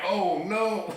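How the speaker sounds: a kid's panicked dread, played for laughs; mock scared. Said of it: "I'm like".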